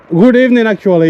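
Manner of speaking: loud, high friendly greeting; calling out. Real, not acted. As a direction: call out cheerfully to a stranger. Greeting stranger loudly.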